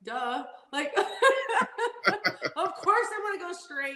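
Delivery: giggling high-pitched disbelief, very slow; laughing at himself. Retold or imitated. Driver in the crash recalls laughing at himself mid-crash. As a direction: laugh through the words at the obvious. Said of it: "I started laughing 'cause I was like".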